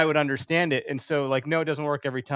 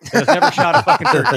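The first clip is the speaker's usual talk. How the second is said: laughing through the words; amused self-mocking laugh; 9 semitones higher, 10 dB louder than usual. Real, not acted. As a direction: laugh it out at yourself. Laughing at himself mid-rant.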